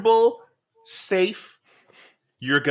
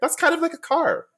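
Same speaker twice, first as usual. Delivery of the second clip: high squeaky voice; 12 semitones higher than usual. Retold or imitated. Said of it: "I was like".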